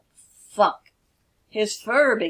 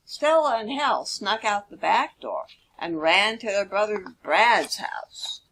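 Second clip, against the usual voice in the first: ordinary delivery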